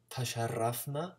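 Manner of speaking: "tasharrafna" with a rolled R; trilled R. Shown as a demonstration. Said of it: "the trill of the R".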